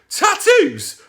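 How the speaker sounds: piercing high shriek of horror; horrified shriek. Acted. Mum shrieking in horror at the idea of tattoos. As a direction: shriek the word in utter horror. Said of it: "Mum gave a horrific shriek".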